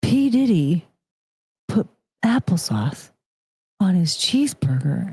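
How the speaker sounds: dramatic hushed whisper; scandalized whisper; whispered reveal. Acted. Shocked reveal.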